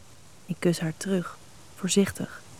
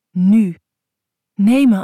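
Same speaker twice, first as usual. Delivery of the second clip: louder, begging outcry; urgent plea; 9 dB louder, slower than usual. Acted. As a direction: cry it out with sudden need. Verlangende vrouw smeekt om seks. (luide smeekbede)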